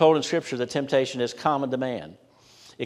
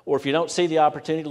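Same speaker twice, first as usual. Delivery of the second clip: ordinary talk, nothing marked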